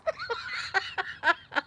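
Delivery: real giggles at the absurdity; amused laughter. Real, not acted. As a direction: laugh helplessly at the punchline. Laughing at the wooden floors.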